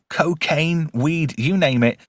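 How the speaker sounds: ordinary delivery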